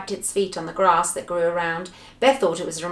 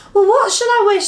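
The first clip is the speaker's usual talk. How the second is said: high, loud bewildered question; flustered; 12 semitones higher, 8 dB louder than usual. Acted. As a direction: ask in excited confusion. Flustered reply to shouting.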